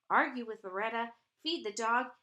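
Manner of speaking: ordinary delivery, nothing marked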